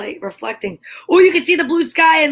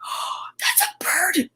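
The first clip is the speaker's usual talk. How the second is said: surprised gasp; 6 semitones higher, 6 dB quieter, slower than usual. Real, not acted. Bird suddenly appears at window.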